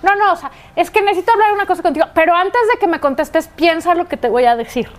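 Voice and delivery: fast pleading daughter; hurried daughter plea. Retold or imitated. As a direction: rush the request out. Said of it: "llega y dice". (hija que suplica rápido)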